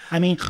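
squealing laugh; cracking up high; high laughter. Real, not acted. Burst of laughter.

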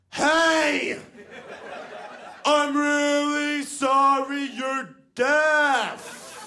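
high, very slow, huge shout; shouting at the deaf. Retold or imitated. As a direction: bellow each word drawn out into someone's face. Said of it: "she got right in my face".

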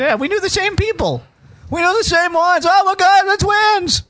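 high, rising gush of fake excitement; mock amazement. Co-host mocks shared coincidence, high over-excited gushing.

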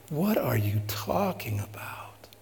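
slow whispered rebuke; hushed rebuke. Retold or imitated. Jesus quietly rebuking James and John, slow whisper. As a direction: breathe it out slowly, almost unvoiced.

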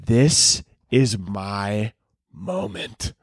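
slow, dropping to a hush; slow relish, trailing into a hush; drawn-out savouring. Retold or imitated. Savouring the retold big moment.

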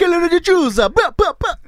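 loud, high mock Jamaican accent; Hitler in Jamaican accent. Parodying a dictator for laughs, loud mock Jamaican accent. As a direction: belt it in cod Jamaican with horn noises. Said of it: "in a Jamaican accent".

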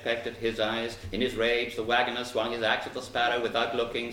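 ordinary delivery, nothing marked

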